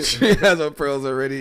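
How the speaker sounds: giggly teasing; laughing. Real, not acted. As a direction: crack up while you say it. Teasing friend about fiance.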